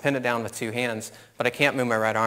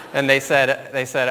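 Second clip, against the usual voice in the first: ordinary talk, nothing marked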